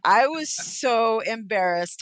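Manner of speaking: normal talking voice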